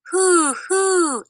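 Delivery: high hooting owl call. A narrator's reading. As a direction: hoo like an owl. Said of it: "Owl said".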